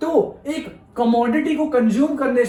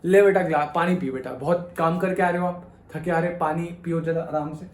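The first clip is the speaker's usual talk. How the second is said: tender mother; 6 semitones lower than usual. Retold or imitated. Mother handing a tired son water, tender. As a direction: soothe a tired son gently. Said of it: "mummy ने दिया".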